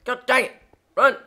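high, loud outburst; exclaiming loudly. Real, not acted. Gamer yelling at the game, loud outburst. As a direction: shout it out in short bursts. Louder repeat, calling out at game.

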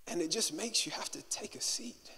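hushed whisper. Acted. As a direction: drop to a breath of a whisper. Pastor pauses over heavy moment.

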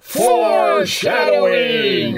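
drawn-out mock announcement shout; mock dramatic shout. Riffer calls out at the screen.

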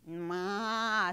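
loud, drawn-out imitated goat call; long, forceful "maa"; goat bleat. A narrator's reading. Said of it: "said the goat".

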